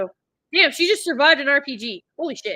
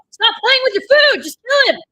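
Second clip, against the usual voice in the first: yelling at the screen; 12 semitones higher, 9 dB louder than usual. Real, not acted. Viewer yelling at screen character.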